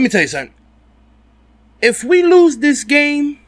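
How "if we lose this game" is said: emphatic raised voice. Real, not acted. Fan rant, rising emphasis.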